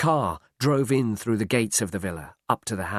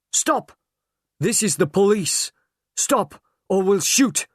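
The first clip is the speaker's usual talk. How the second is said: loud, high commanding shout; police yell a warning at gunpoint; 10 semitones higher, 5 dB louder than usual. A narrator's reading. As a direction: order them to freeze, full volume. Police ambush at villa.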